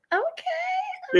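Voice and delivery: high squealed oh okay, drawn out; mock amazed squeal; impressed gasp. Host squeals at the guest's accent demo, surprised. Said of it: "I heard it. I was like".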